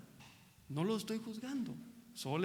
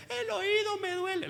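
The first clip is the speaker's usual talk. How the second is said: put-on high, small baby voice; 12 semitones higher than usual. Pastor voicing a baby to the congregation, high baby voice. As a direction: speak as a little baby complaining. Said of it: "Y el bebé".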